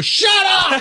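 high, loud shout of shut up; mock shout. Wanting to silence the bickering couple on the stairs, loud mock shout. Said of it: "I wanted to go around the corner and go".

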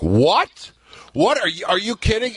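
mock disbelief. Staff told smoking is banned.

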